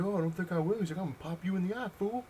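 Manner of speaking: high-pitched imitation of a cocky gunman; puffed-up mock threat; mock threatening. Gunman threatens to shoot laughing storyteller, mock menacing.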